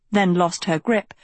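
ordinary delivery

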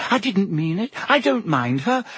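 high-pitched, flustered backpedalling; anxious, squeaky protest; frantic. A narrator's reading. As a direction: hurriedly cover up in a panic. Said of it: "Hermione whispered frantically".

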